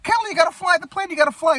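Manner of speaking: high, frantic retold plea; mock panic. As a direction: urge it in a squeaky mock panic. Retold panic in crashing plane.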